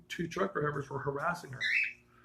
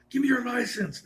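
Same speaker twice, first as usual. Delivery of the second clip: mildly angry order, pitched up; imitated cop's higher-pitched command; retold serious officer; 6 semitones higher, 4 dB louder than usual. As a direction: act out a stern officer's demand. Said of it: "and he was serious".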